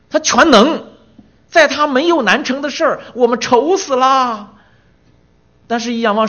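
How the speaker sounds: high, loud, slowing on 愁死啦; fervent preaching. Acted. Preacher contrasting God's power with our worry, loud. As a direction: declare God's might.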